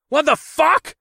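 high, loud startled yell; shocked shout. A narrator's reading. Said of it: "I shouted".

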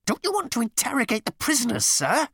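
high, squeaky, anxious squeal; squealing underling. A narrator's reading. Number two squealing at his captain. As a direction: squeal it up at the boss like a fretful subordinate. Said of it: "he squealed".

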